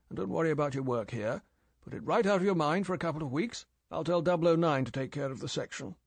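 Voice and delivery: brisk, matter-of-fact boss; brisk old chief. Acted. M briskly arranging leave.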